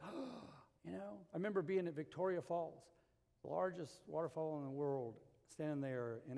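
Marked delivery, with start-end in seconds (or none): 0.0-0.5 mock awed gasp. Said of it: "they're just like".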